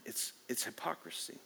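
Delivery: breathy whisper of hypocrisy; whispered verdict. Real, not acted. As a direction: hush it like a secret.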